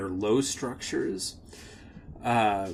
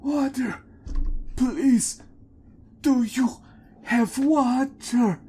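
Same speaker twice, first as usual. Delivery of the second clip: put-on high, pleading old woman's voice; old woman begging strangers for water, high and desperate; 13 semitones higher, 5 dB louder than usual. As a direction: beg for water, voice straining high. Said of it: "this old woman rushes up to you and says".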